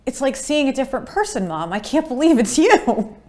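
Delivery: light imitation of an amazed son; lightly voiced son, amazed; son's amazed reaction. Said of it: "he would go".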